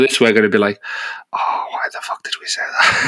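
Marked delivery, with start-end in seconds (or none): 1.3-3.1 mock-mortified whisper; hushed, voiceless mock regret; cringing whisper. Imagined cringing on listening back.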